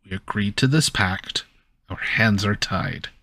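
normal talking voice

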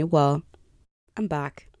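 ordinary talk

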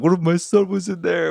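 high-pitched, loud imitation of crying; mock bawling. Neighbor breaking down after the gun went off, imitated bawling. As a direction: wail it like a panicked father, mockingly. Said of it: "He starts fucking bawling, like, crying".

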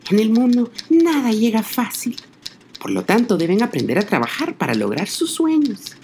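high, gentle mother pig; a caring mama pig giving advice. A narrator's reading. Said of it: "les dijo".

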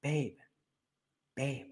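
calm him down gently, almost inaudible; soft soothing babe. Retold or imitated. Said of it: "and you go".

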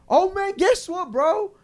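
high, drawn-out excited buddy voice; mock eager greeting. Mocking a stranger who claims he's from New York, mock eager. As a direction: imitate an overeager stranger breaking big news.

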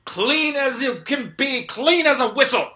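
high, loud victory cry; triumphant hero shout. Acted. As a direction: crow over the beaten villain.

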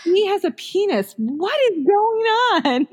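high, astonished mock neighbor voice; shocked neighbors. Mom imitating neighbors, high mock astonishment. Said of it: "people are like".

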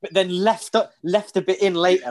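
ordinary talk, nothing marked

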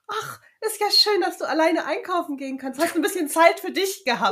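high, loud, gushing sing-song; imitated beaming delight. Mother-in-law greeting her back from shopping, mock overjoyed. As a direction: coo it with overdone joy. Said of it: "meinte sie so ganz freudestrahlend".

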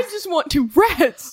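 mock whiny plea. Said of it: "I yelled".

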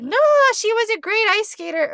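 put-on loud, high-pitched Midwestern accent; Midwestern mom. Imitating a mom praising her skater daughter, Midwestern accent. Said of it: "I'm being Midwestern".

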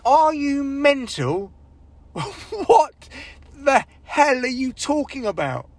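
high, slow, incredulous crowd voice with a laugh; mock audience scorn. Audience judging the comedian, slow mock disbelief. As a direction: stretch the disbelief out. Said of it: "people look at you like".

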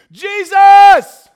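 very high, drawn-out holler; piercingly loud cry for help; full-force shout. Acted. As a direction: yell the name as far as it will carry. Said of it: "He began shouting".

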